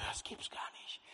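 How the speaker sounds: low whispered disbelief; a whispered aside in disbelief; astonished whisper. Retold or imitated. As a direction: breathe it out in hushed amazement.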